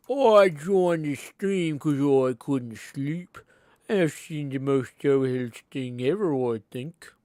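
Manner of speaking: loud, slow, swooping delivery; put-on reading voice. Streamer reading out a sleepless viewer's message, silly voice. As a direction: stretch the words out in a silly voice.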